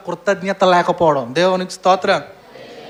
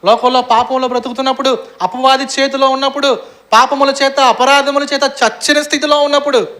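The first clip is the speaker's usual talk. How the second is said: preaching, building higher and louder; rising sermon; 5 semitones higher, 5 dB louder than usual. Acted. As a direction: drive the point home to the congregation.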